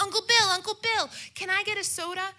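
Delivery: put-on high, loud kid begging; high whiny child calling her uncle; childish plea. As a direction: beg like a frantic little kid. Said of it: "And I'm like".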